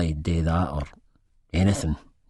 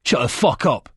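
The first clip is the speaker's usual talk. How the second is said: raised, higher-pitched snapped order; angry snap; 5 semitones higher, 4 dB louder than usual. A narrator's reading. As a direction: snap it to silence someone. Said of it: "Chizzy snaps".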